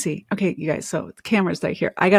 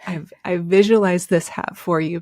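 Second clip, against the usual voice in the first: ordinary delivery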